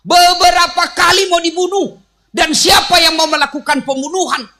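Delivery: high, loud fervent preaching; a preacher shouting to the congregation. Real, not acted. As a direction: drive it home to the crowd.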